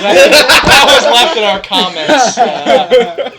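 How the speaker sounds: laughing loudly through words. Real, not acted. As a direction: talk while cracking up. Laughing at comment.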